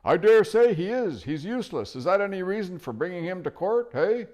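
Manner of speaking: put-on high, loud cranky old dwarf; deaf old dwarf. Dwarf cupping his ear at the owl, loud and cranky. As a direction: bark it like a deaf old man.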